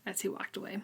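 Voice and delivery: unvoiced whispered end. Real, not acted. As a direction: let it fade to a whisper. Trailing aside, unvoiced.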